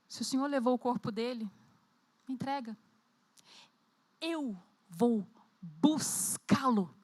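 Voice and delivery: hushed, slow, steadied resolve; after a deep breath. Pretend. Mary at the tomb answering the gardener, hushed and slow. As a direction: drop the voice and say it slowly, holding back tears. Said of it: "Ela deve ter respirado fundo e falado".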